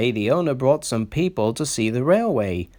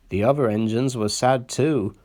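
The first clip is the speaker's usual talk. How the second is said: ordinary delivery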